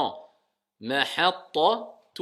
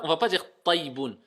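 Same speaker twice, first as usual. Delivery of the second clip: ordinary talk, nothing marked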